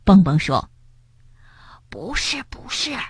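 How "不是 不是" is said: soft urgent hushed no; quietly. Acted. Frog insisting quietly to his friend, hushed. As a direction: hush the denial. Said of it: "跳跳小声说".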